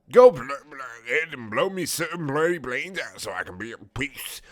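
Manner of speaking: high, stammering mock yell; hammed-up British outburst; yelled plea. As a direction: burst out loudly, tripping over the words. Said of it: "I yelled out at the turret".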